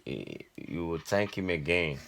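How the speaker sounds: normal talking voice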